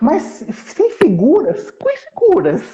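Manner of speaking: slow then high, incredulous question; mock disbelief. Teacher teasing the listener about the title, mock disbelief. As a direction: act amazed there are no pictures.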